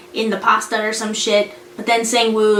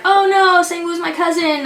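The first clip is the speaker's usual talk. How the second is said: lightly voiced, higher-pitched flustered cover-up; mock innocent excuse; 6 semitones higher than usual. Intruder making excuses to a cop, mock flustered. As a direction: jump in high with a hasty excuse. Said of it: "And then Yoon Bum's like".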